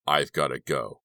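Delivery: ordinary delivery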